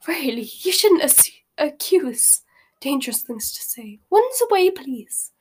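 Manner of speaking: high-pitched, flustered protest; flustered squeal. A narrator's reading. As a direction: plead in alarm, pitch leaping up. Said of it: "Madame Malkin squealed".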